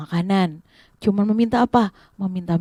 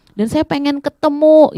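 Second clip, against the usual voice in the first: high, raised plea; acted calling out; 5 semitones higher, 4 dB louder than usual. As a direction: call out eagerly over the crowd. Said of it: "dia teriak-teriak gitu ya". (seruan memohon bernada tinggi)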